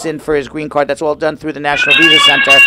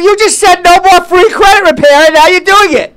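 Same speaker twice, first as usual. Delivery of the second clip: much higher and louder, hammed-up disbelief; mock outrage; 14 semitones higher, 7 dB louder than usual. Co-host interrupts the free offer, hammed-up shocked shout. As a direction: shriek in fake shock.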